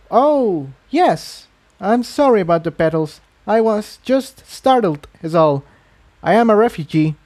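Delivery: a flustered mare, apologising after startling him; Incendia's voice. A narrator's reading. Narrator lightly voicing a startled Incendia apologising to the stallion.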